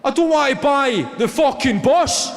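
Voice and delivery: hammed-up indignant yell; outraged passenger losing it. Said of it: "the guy just lost it".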